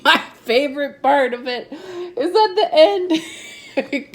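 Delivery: high, loud, laughing through the words. Real, not acted. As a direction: talk through real giggles. Breaks into laughter while telling.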